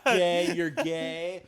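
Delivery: drawn-out giggly taunt; laughing through a child's taunt. Retold or imitated. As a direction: chant it while cracking up. Child chanting taunt, teller laughing.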